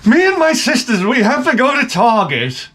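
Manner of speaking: mocking the wife's sudden Target plan; imitating wife. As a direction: squeak it out like his wife mimicking her. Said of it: "my wife's like".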